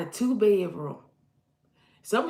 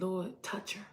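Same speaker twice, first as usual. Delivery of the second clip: quiet prayer; 9 dB quieter than usual. Retold or imitated. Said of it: "I said".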